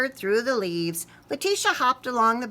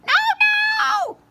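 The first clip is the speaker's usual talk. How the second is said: very high shrieking mouse; screaming scared little mouse; terrified squeal; 11 dB louder than usual. Acted. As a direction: shriek it in panic.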